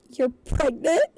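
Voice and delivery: shocked question, voice leaping up at the end; gasping surprise. A narrator's reading. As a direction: gasp out the question. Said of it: "Sharon gasps".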